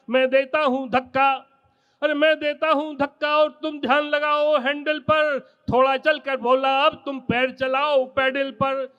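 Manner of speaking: normal talking voice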